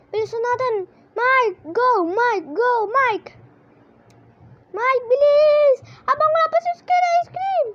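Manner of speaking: high, loud panicked calls to hurry; frantic urging. Real, not acted. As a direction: squeal at a teammate to go now. Urges Mike to hurry.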